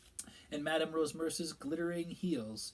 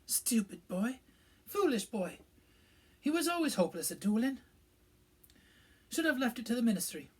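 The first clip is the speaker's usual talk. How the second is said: higher, a touch choked by tears; thick with tears; 10 semitones higher, 7 dB louder than usual. A narrator's reading. Professor mourning her old student, tearful and cross. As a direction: scold through a blocked, teary nose. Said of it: "said thickly".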